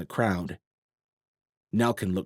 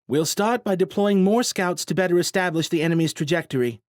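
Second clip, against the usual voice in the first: confident commander; 9 semitones higher, 5 dB louder than usual. A narrator's reading. Daniels briefing the crowd.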